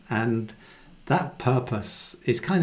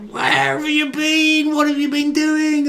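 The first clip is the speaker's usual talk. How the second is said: anxious mother; 14 semitones higher, 3 dB louder than usual. Retold or imitated. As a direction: let the panic of a lost child pour out. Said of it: "you don't say it like that".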